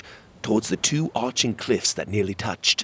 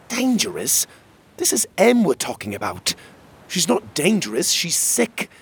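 high-pitched, incredulous protest; indignant disbelief; 10 semitones higher, 3 dB louder than usual. Acted. Defending sick Em against Magborn, indignant. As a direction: leap up high in defence of a friend. Character protests Em is called dangerous.

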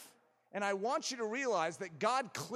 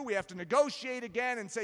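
ordinary talk

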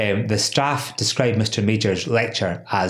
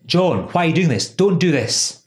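pleading plea; 7 semitones higher than usual. Retold or imitated. Retelling the victim begging the boss, raised pleading voice. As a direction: beg him to stop, a little raised. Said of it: "And he said".

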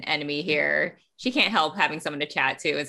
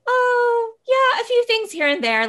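breezy understatement; 7 dB louder than usual. Acted. Winley casually about deadly traps.

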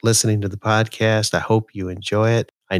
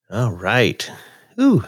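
ordinary talk, nothing marked

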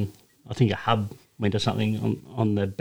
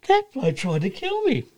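much higher and slow, hammed-up scared driver; mock frightened Vaughn; slower than usual. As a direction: whine it like a shaken mate. Vaughn repeats his claim.

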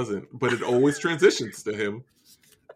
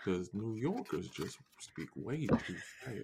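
slow, low, soft drawl; drawn-out, laid-back Southern delivery; 3 semitones lower, 13 dB quieter, slower than usual. Shown as a demonstration. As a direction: take your time, easy and unhurried. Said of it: "you gotta slow down".

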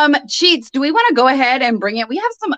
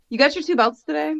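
usual speaking voice

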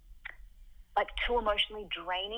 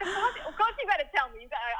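a rough, higher imitation of a working-class Brooklyn guy; Brooklyn accent; 8 semitones higher, 4 dB louder than usual. Ex-boyfriend's blunt reply, in a butchered Brooklyn accent. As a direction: do his Brooklyn accent, badly and on purpose. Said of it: "I'm butchering his accent".

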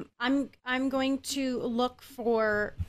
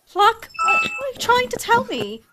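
alarmed; 8 semitones higher, 5 dB louder than usual. Acted. Player alarmed at parrot.

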